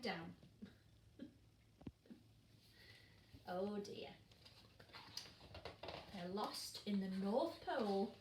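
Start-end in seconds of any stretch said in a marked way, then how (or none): none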